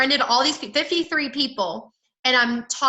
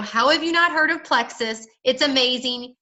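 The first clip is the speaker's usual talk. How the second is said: ordinary talk, nothing marked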